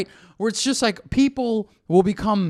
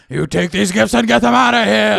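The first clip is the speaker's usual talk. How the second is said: louder action-hero impression; Arnold voice; 2 semitones higher, 7 dB louder than usual. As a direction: order it like Arnold Schwarzenegger. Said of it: "Like Arnold?".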